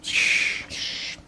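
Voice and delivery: hushing whisper. Pretend. Hushing her.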